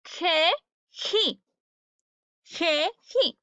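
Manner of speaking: teacher's repeated "Je, ji", Spanish j sound; correct soft G. Shown as a demonstration. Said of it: "¿cómo se pronuncian estas dos vocales?".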